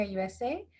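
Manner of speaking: usual speaking voice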